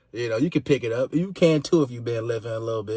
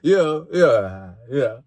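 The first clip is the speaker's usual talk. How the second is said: fake polite laugh; 5 dB louder, much slower than usual. Shown as a demonstration. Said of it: "hit you with a little".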